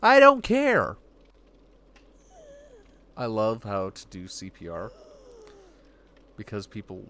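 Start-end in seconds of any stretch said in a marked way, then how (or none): 0.0-1.0 loud, high burst of exasperation; exasperated. Real, not acted. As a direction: throw it out fed up. Exasperated outburst at AI.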